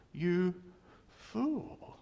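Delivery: "fool" pitched high and stretched out, rising at the end; Jesus rebuking, high. Retold or imitated. Preacher voicing Jesus at the rich man, high mocking rebuke. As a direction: fling "fool" up high in mock disbelief. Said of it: "And Jesus goes".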